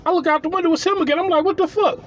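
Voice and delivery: high-pitched what the fuck; comic high disbelief; exasperated disbelief. Retold or imitated. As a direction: throw up your hands in disbelief. Sees neighbor again, exasperated.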